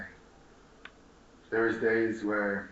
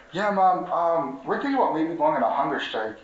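loud, raised telling of news to mom; retelling to mom; 5 semitones higher, 6 dB louder than usual. Retold or imitated. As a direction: announce it loudly at the dinner table. Telling mom about hunger strike.

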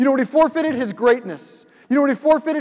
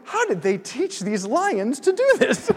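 slow, amused mock wonder with a laugh in it; retelling a naive thought through laughter; laughing disbelief; 5 semitones higher than usual. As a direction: play dumb and laugh through the question. Retold naive thought while watching.

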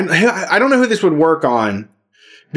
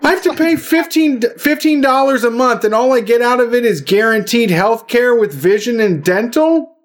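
sarcastic disbelief, much higher; mock outrage; 5 semitones higher than usual. To his co-host about union dues, a sarcastic mock outrage. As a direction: play up fake shock at a great deal.

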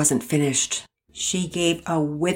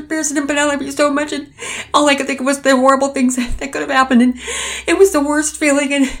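high, fast, loud, breaking into tears; mother confessing her fear to husband; 11 semitones higher, 8 dB louder, faster than usual. Acted. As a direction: spill the fear out as it cracks.

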